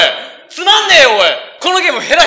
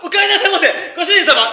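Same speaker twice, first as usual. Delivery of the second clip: Takeshi impression; 6 semitones higher than usual. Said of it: "俺、たけしばっかりだな".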